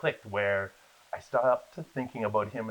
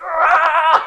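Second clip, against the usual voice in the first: loud, high scream of joy; elated scream; 14 dB louder than usual. Retold or imitated. Retelling getting into the program, jubilant scream. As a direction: scream as if celebrating wildly.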